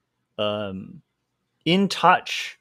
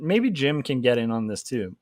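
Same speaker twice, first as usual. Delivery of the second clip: normal talking voice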